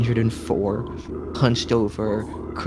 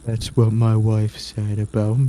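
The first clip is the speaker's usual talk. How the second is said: mopey clown; 4 dB louder than usual. Acted. GM voices a sad clown.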